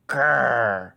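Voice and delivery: a loud, gruff engine growl; angry growl. A narrator's reading. Diesel ten growling at his pals, loud angry growl. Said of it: "Diesel ten growled".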